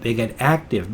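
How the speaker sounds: ordinary delivery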